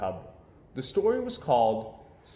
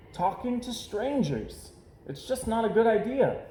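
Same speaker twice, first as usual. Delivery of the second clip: a hint of a cautious boy; 9 semitones higher than usual. Brother explains to Sister.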